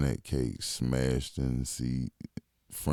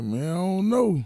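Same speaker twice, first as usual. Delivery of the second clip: doubtful; 4 dB louder than usual. Retold or imitated. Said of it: "I'm like".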